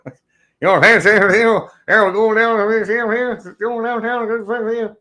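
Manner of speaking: high-pitched rapid mumble, words slurring together; Boomhauer. Retold or imitated. Streamer mocking the bearded boy's accent, high Boomhauer mumble.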